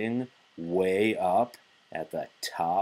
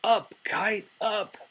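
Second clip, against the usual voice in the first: high, eager call to the kite; mock kite shout; 13 semitones higher than usual. Frog showing Toad how to call up the kite, high. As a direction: cheer the kite upward, high-pitched. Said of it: "and shout".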